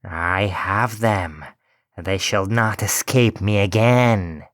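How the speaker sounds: slow, mocking old witch, lightly voiced; a hint of a mocking hag. A narrator's reading. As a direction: gloat over the children as a witch. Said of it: "and said mockingly".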